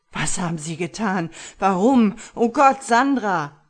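high, very loud anguished shouting; desperate cry. Acted. Husband grieving and panicking, desperate loud cries. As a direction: cry out in despair. Husband despairing over dead wife.

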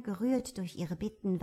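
plain reading voice

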